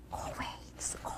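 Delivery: whispered go away. Acted. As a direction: hiss it under the breath. Whispered shooing.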